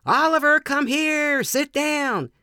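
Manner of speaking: high, loud call for a runaway dog. Acted. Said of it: "riding around calling".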